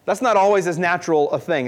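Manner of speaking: ordinary talk, nothing marked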